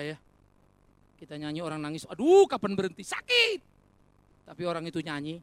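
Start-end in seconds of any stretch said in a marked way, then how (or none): 2.1-3.6 loud, high mock cry of pain; mock pained listener. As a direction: whine like your ears hurt. Listeners suffering through bad singing. (pendengar pura-pura kesakitan, keras dan tinggi)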